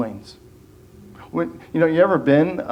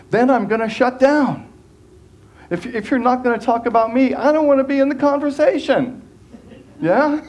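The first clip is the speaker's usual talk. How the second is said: mock self-centred whine; 8 semitones higher than usual. Mimics a self-centred person.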